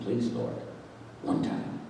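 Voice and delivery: whispery, very soft prayer; low, barely audible begging; bargaining plea. Retold or imitated. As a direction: plead with God under your breath. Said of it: "I'm bargaining with him".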